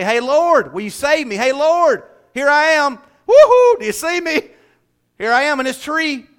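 high, eager mock holler; hammed-up attention-seeking call. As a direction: hail someone from up in a tree. Zacchaeus imagined calling from tree.

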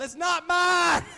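high, drawn-out shout of protest; yelling. Retold or imitated. Man yells to a boy in a passing car, shouted denial. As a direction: holler it after a departing car, stretching the last word. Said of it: "and I'm yelling".

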